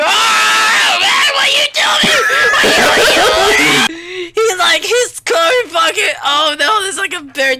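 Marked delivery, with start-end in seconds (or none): 0.0-4.3 high, wailing shout of protest; frantic, high cry of disbelief; panicked yelling. Real, not acted. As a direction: yell at a teammate in alarm. Teammate causes panic.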